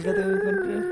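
put-on high childish voice; excited kid. Kid showing his mom the found cat, high. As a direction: show off the cat like a small child.

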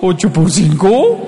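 slow, dragged bewildered repeat; scared kid repeating the question blankly; confused child. Retold or imitated. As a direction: stall in confusion.

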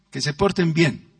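ordinary delivery

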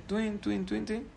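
tiny high, very soft "tuin" sounds; imitated falling twinkle. Storyteller imitating a paper falling from heaven, soft high twinkling. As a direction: chime softly in a high little voice.

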